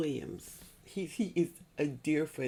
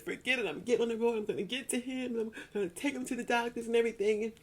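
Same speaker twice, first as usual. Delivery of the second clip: imagined resolve; 8 semitones higher, faster than usual. Retold or imitated. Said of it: "I just should have just said".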